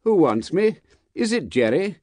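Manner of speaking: high-pitched, slightly anxious questions; mildly quavering. A narrator's reading. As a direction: ask with a mild quaver. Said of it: "with mildly quavering speech".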